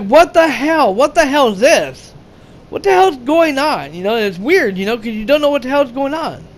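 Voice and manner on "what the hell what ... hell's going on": raised, pitched-up disbelief; shocked outburst. Retold or imitated. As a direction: blurt it out in confused alarm. Retelling shocked soldiers in movie.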